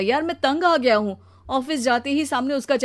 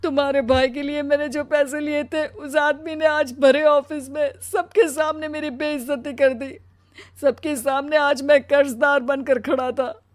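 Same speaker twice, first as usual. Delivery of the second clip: crying voice, pitched up, growing louder; tearful husband; 6 semitones higher, 5 dB louder than usual. Acted. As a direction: pour out the humiliation through acted tears. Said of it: "वो रोने लगता है". (रोते हुए ऊँची, दुखी आवाज़)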